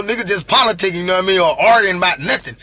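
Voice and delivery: ordinary talk, nothing marked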